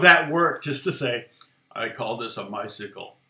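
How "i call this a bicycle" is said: deadpan. Retold or imitated. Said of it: "his delivery was really deadpan".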